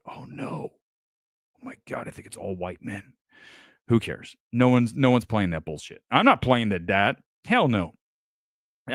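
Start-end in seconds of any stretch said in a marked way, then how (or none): 0.1-3.1 breathy whispered gasp of fake shock; mock whisper. Mock dismay at all-male nominees.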